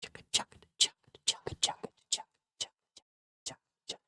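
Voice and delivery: whispered chugga-chugga train sound; train noise. Pretend. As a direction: breathe out a soft locomotive chug. Imitating a train while working.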